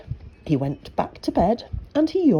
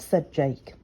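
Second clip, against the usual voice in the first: ordinary delivery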